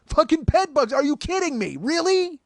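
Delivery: very high, loud incredulous shriek; outraged disbelief. Retold or imitated. Reliving the bug discovery in high shrieking disbelief. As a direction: squeal it in disbelief.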